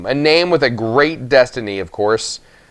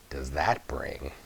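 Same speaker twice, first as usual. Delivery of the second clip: low, much softer and darkly hushed; ominous hush; 8 semitones lower, 15 dB quieter, slower than usual. A narrator's reading. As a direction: let it sink, sinister and soft. Narrator broods on destiny.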